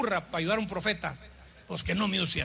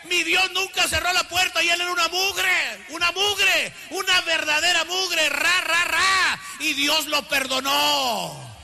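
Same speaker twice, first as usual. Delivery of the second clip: high, sustained shouted pulpit voice; fervent sermon cry, long drawn-out ending; full-force preaching; 5 semitones higher, 4 dB louder, slower than usual. Real, not acted. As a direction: preach it out, stretching the phrases.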